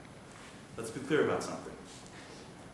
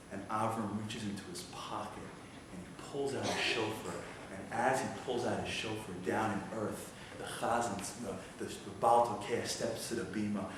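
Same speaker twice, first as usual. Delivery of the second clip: low, very soft narration with long pauses; hushed suspense storytelling; 6 semitones lower, 12 dB quieter than usual. Acted. Preacher narrates Satan's victory slipping away, hushed suspense. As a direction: draw them in quietly, pause between phrases.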